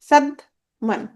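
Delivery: Sadman in two slow beats, সাদ, then মান; split into syllables. Shown as a demonstration. Teacher counting syllables for students, Sadman split slowly in two. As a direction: break Sadman with a clear gap before মান. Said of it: "দুইটা অক্ষর".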